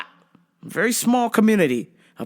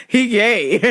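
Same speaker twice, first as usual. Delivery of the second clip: higher, louder words through laughter; laughing; 6 semitones higher, 4 dB louder than usual. Real, not acted. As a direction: squeeze it out while cracking up. Laughing mid punchline.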